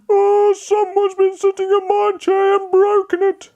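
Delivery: put-on very high, whiny baby bear voice; crying Baby Bear. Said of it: "he started to cry again".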